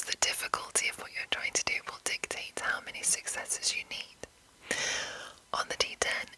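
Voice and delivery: ordinary delivery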